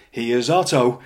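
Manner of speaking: a loud, hammed-up showman's introduction into the camera; mock announcement. [name] mocking Otto to the camera, in a loud showman's voice. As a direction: punch it out like a cheeky TV presenter.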